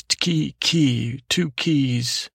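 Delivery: KEY, KEY as two separate, spaced beats; name split in two. Shown as a demonstration. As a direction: say "key, key" syllable by syllable, then "two keys". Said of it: "I said it twice".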